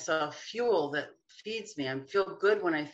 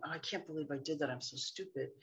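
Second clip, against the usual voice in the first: softer, quick self put-down; self-critical mutter; 11 dB quieter, faster than usual. Retold or imitated. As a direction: imitate muttering an insult at yourself. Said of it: "when you find yourself saying".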